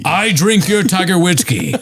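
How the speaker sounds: high, hammed-up villain line; mock movie villain. Quoting the film to his cohost, hammed-up and high. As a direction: ham up the movie villain quote. Quoting There Will Be Blood.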